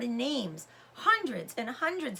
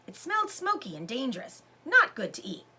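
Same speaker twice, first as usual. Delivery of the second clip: ordinary delivery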